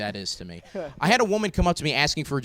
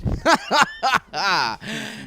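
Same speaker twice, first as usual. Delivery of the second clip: awkward laugh; 8 semitones higher than usual. Real, not acted. Laughs at own awkward joke.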